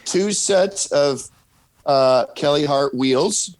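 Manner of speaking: put-on slowed down for a child; slow, careful. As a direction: spell it out slowly.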